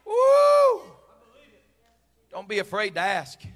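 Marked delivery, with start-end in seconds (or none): 0.1-0.8 high, loud "woo"; exultant whoop. Real, not acted. Preacher after a climax, exultant whoop. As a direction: let out a long high whoop.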